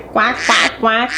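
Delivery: quacky duck voice; calling ducks. A narrator's reading. Ducks call out by the pond, quacking. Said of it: "they called".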